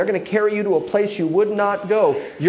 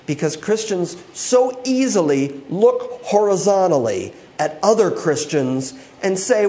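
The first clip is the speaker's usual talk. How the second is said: ordinary delivery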